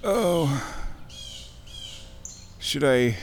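ordinary talk, nothing marked

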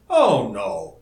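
breezy, emphatic dismissal; light rejoinder. A narrator's reading. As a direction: wave the worry away lightly. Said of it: "he rejoined lightly".

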